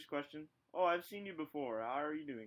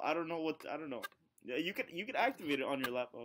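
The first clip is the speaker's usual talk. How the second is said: ordinary talk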